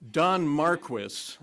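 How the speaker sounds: anglicized Marquis, MAR-kwiss with the final s sounded; pronounced his way. Shown as a demonstration. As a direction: say Marquis as MAR-kwis, announcing the name slowly. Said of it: "that is the way he pronounced his name".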